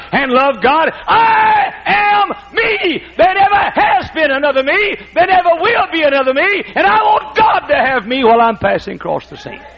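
loud, high climactic preaching; full preaching force. Real, not acted. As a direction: drive the sermon to its peak. Sermon climax.

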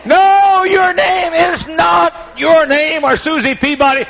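high, loud, slow comic yell; hammed-up frustration at the wrong answer; mock exasperated shout. Shown as a demonstration. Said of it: "mock frustration".

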